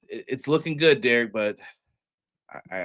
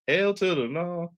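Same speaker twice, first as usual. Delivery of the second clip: sassy refusal; 5 semitones higher than usual. Retold or imitated. Joking exclamation.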